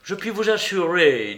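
lightly voiced Holmes, cool and reproachful; Holmes accusing. A narrator's reading. Holmes urging the traitor to confess, coolly reproachful. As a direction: lay out his guilt, measured and cold.

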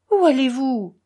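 loud, uneasy question; worried. A narrator's reading. Grouchenka asks the departing men, worried. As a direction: call after them anxiously. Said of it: "demanda Grouchenka inquiète".

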